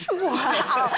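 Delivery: laughing, breathless-style wow; amazed laugh. Retold or imitated. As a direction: let laughter burst through the amazement. Acts out her own stunned reaction.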